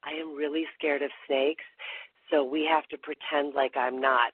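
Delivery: ordinary delivery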